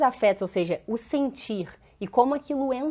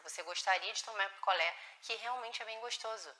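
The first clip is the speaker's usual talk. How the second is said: much softer, loving but setting limits; tender firm mother; 2 semitones lower, 10 dB quieter than usual. Shown as a demonstration. Mother calming her son who insulted her, much softer and tender. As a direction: hold the line with love, hushed. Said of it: "A gente tá educando uma criança".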